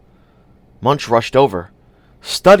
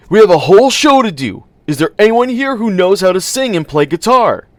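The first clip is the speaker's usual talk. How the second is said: high, loud appeal; loud announcement; 9 semitones higher, 7 dB louder than usual. A narrator's reading. Munch asking the crowd for a singer, high and loud. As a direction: call out across the crowd.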